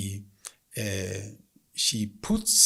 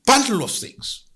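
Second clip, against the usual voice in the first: exasperated spirit; 11 semitones higher, 5 dB louder than usual. Acted. Spirit scolds the woman.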